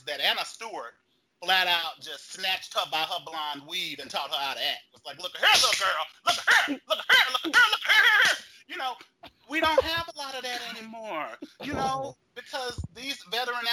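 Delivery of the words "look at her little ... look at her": shrill yelled repetition, rising higher and louder; mock acting coach yelling. Acting coach yelling at a young actress. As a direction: bark it like a furious acting coach.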